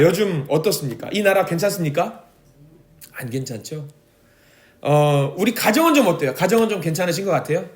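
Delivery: usual speaking voice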